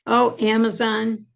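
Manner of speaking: ordinary talk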